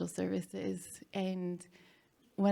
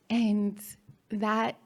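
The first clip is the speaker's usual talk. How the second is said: normal talking voice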